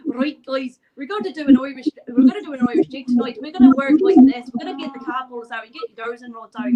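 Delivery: loud, mock Irish event host; rallying a group in fake Irish; put-on Irish accent. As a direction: announce it in hammed-up Irish. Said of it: "But then I just start talking English".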